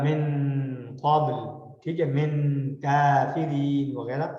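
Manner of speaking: من قبل very slow with a hidden nasal n; slow tajweed with a nasal hum on من قبل; recited with ikhfa. Shown as a demonstration. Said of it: "آپ کی sound میں غنہ شامل ہو". (من قبل اخفا کے ساتھ بہت آہستہ)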